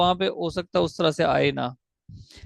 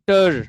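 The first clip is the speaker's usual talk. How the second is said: टर alone, louder, as one unbroken sound; single syllable; 2 semitones higher, 7 dB louder than usual. Shown as a demonstration. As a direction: punch out टर as a separate syllable. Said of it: "टर भी एक single uninterrupted sound". (टर को ज़ोर से एक अलग सिलेबल में बोलें)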